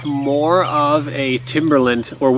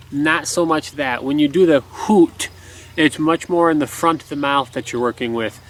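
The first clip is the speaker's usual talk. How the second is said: ordinary talk, nothing marked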